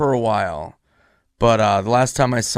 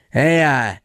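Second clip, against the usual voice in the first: lightly imitated, hesitant phone greeting; Norm; 4 semitones higher than usual. Friend imitating the comic calling a month later, light impression. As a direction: open the call with a stretched, slightly raised "hey, uh". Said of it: "he calls me and he goes".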